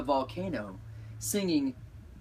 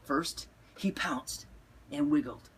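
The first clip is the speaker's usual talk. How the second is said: whispered. A narrator's reading. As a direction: whisper it playfully. Hushed dramatic storytelling.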